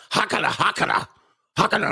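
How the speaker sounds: high, harsh mock-Swedish gibberish; aggressive mock Swedish. Streamer showing how Swedish women talk, aggressive mock Swedish. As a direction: bark out fake Swedish syllables, high and aggressive. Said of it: "all aggressive".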